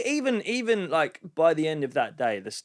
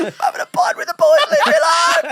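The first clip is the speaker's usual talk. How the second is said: put-on much higher, louder laughing drunk protest; higher, louder hammed-up drunk insisting, laughing; drunk mate; 8 dB louder than usual. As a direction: squeal it like a pub lad defending his pints. Friend voices the drunk mate.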